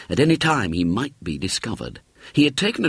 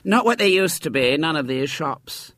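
grumbling mother voice; 9 semitones higher than usual. A narrator's reading.

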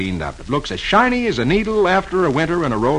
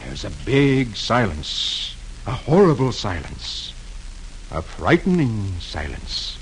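low, soft, slow narration; suspenseful hush; 6 semitones lower, 7 dB quieter than usual. A narrator's reading. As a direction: hush it for the eerie silence. Sudden frightening silence in the story.

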